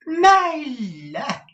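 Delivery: high, loud, gushing dandy voice; foppish Mantalini. A narrator's reading. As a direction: swoon over your wife like a preening fop. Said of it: "said Mister Mantalini".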